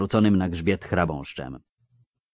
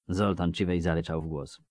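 plain reading voice

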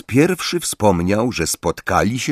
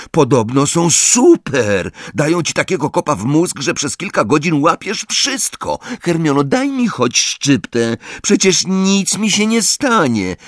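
high-pitched, eager pleading; worked-up boy protesting; huffy insistence; 9 semitones higher than usual. A narrator's reading. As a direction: argue your case and beg for some. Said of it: "zaperzył się Ron".

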